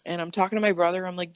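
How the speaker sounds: usual speaking voice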